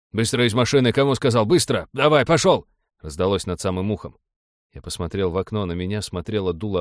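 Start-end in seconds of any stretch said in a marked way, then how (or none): 0.1-2.7 impatient, raised commanding voice; loud, urgent command; barked orders. A narrator's reading. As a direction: hurry him out, building to a shout. Armed man orders driver out.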